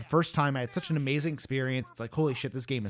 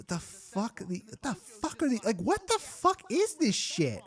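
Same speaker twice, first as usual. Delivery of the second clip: incredulous disbelief; 8 semitones higher, 3 dB quieter than usual. Real, not acted. Reacting to a silly name.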